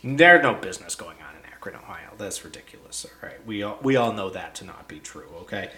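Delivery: unvoiced, hushed conspiratorial aside; whispered snark. Real, not acted. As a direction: lean in and whisper it.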